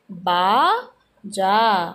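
बाजा stretched very slowly, both syllables held; slow drawn-out word. Shown as a demonstration. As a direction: draw out बाजा for the class. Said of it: "क्या हो गया?".

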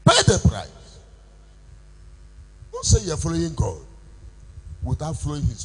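rally the faithful; preaching exhortation. Acted. Pastor urges the congregation to pay the price, preaching hard.

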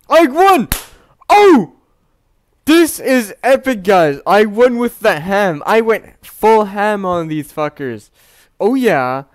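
very high, very loud triumphant yelling; victory shout. Real, not acted. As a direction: scream with joy at winning. Celebrating victory.

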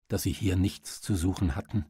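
plain reading voice

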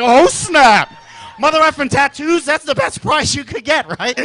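put-on loud, excited kid voice; hyped yell. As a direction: shout with pure glee. Kid hyped about tattoos.

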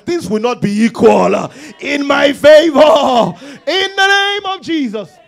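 high, loud preaching voice; full-force preaching. Real, not acted. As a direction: proclaim it loudly, drawing out the key phrases.

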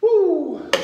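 loud high cheer; triumphant whoo. Real, not acted. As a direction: whoop after the set. Cheering after the set.

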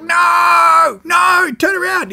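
frantic high cry, slowing on "turn around"; panicked shout. Retold or imitated. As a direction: shout at the skipper to turn back for the tuna. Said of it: "I'm like".

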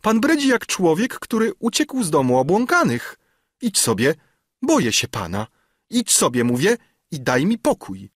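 put-on high-pitched, indignant young lady; agitated outcry. A narrator's reading. Albertyna drives off an unwanted suitor, crying out. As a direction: push him away in a high, agitated voice. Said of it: "zawołała Albertyna".

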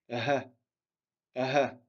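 ah breathed out as if winded; breathless ha. Shown as a demonstration. Teacher demonstrates ha as if out of breath. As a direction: push air out like after a run. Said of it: "comme si on était essoufflé".

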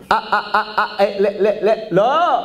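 imitated stutter on broken syllables. Preacher asking whether Moses stuttered, imitated stuttering. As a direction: get stuck repeating the first sound. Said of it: "מגמגם".

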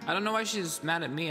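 ordinary delivery

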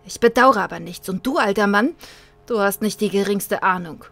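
put-on loud, defiant boy with a grin; loud grinning defiance; rebellious defiance. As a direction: throw it back at the old man. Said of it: "zu einem rebellischen Grinsen".

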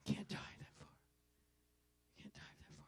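hushed whispered repeat; whispered echo. Real, not acted. Whispered echo after shouting.

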